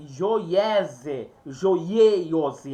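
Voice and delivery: "Joiese, Joieiose" with invented extra vowel glides; mock made-up readings, "Joiese, Joieiose", syllable by syllable; pronúncia inventada. Shown as a demonstration. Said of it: "Eu não posso inventar". (pronúncia inventada de "Joiese, Joieiose", com vogais a mais)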